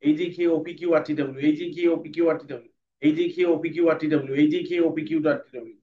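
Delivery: letters rattled off fast, over and over; speedy reading. Shown as a demonstration. Teacher drilling class at speed. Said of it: "পরে speedy করতে হবে".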